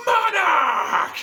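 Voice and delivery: sudden screaming yell, very high and loud; wild mock-villain scream of the name; dramatic shout. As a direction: bellow the name like a supervillain. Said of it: "or as I like to call it".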